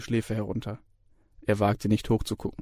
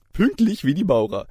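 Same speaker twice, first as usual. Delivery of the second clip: higher, hearty jovial lecturer voice; cheerful Herr Ossip; 6 semitones higher, 4 dB louder than usual. A narrator's reading. Lecturer greets the student, jovial and higher. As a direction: beam out the greeting. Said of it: "rief Herr Ossip gut gelaunt".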